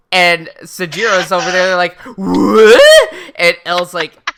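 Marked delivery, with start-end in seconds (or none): 2.2-3.1 loud, high mock shock; shocked outburst. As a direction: yelp it in exaggerated disbelief. Sajiro reacts to Light's confession.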